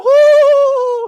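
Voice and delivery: high, loud falsetto "ohoho" laugh; cartoonish high-pitched giggle; mock squeaky giggle. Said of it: "That's how he talked".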